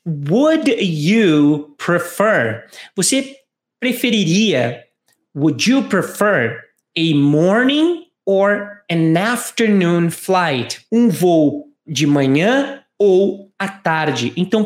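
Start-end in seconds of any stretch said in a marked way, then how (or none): 0.1-2.7 slow, careful question read; slow model question. Shown as a demonstration. Teacher reading the other speaker's question slowly. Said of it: "o B diz o seguinte". 5.3-10.9 slow, careful read of the question; deliberate teaching pace; slow model question. Shown as a demonstration. Teacher modelling the dialogue question slowly for repetition. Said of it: "o B diz o seguinte".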